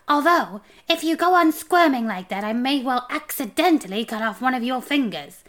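high, loud, taunting warning; threatening homunculus. A narrator's reading. Homunculus warning the squirming dwarf, high and menacing. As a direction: pitch it up and toy with the threat.

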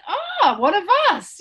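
high, loud exclamation of welcome; delighted recognition. Retold or imitated. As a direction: imitate them greeting one of their own. Acted quote of the Dutch.

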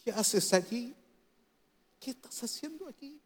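very soft, almost whispered tenderness; hushed, tender concern; gentle, loving. Acted. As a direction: ask it softly, like a caring father to a son in crisis. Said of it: "¿cuál va a ser el tono de Dios?".

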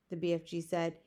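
ordinary delivery, nothing marked

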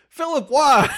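loud, high-pitched cry of disbelief; hammed-up despairing shout; exasperated mock wail. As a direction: plead loudly, pitch shooting way up. Exasperated mock plea to Philip.

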